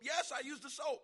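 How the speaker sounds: high, loud indignant reply; mock-defensive yell; imitated exasperated shout. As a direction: insist at the top of your voice. Acted exasperated answer.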